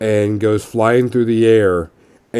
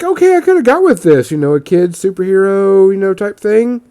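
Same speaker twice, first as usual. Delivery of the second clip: mock inner voice; 9 semitones higher, 3 dB louder than usual. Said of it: "And I was like".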